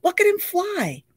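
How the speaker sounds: high, slow, thrilled; excited awe. A narrator's reading. Girl watching the skydiver land, awed. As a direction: marvel at the flyer.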